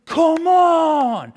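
high, loud, drawn-out cheer; the whole line of kids yelling her home; crowd of kids cheering. Retold or imitated. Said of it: "they were going".

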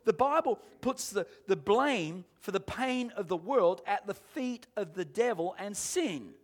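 normal talking voice

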